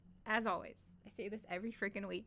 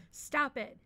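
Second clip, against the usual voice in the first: higher, louder urgent cry; shriek; 4 semitones higher, 8 dB louder than usual. Acted. Apprentice trying to save her friend, restrained shriek. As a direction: cry out to halt an attack. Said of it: "Nitika shrieked".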